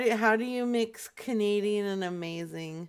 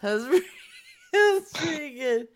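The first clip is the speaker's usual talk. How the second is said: high, loud, breaking into laughter; laughing while talking; 7 semitones higher, 5 dB louder than usual. Real, not acted. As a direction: struggle to speak through the giggles. Breaking up after own impression.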